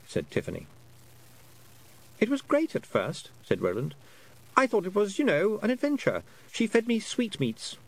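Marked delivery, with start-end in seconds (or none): 2.2-7.8 put-on high, lightly boyish voice, puzzled; a lost boy working out how long he's been away; young boy Roland, unsure. A narrator's reading. Lost boy telling Tiffany about time, high and puzzled.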